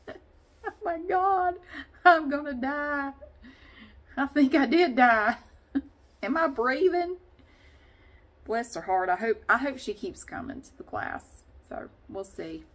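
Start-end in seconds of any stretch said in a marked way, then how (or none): 0.1-7.2 higher, louder, slow half-crying groan; mock exhaustion. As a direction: ham up being wrecked by the class. Mock dying after workout.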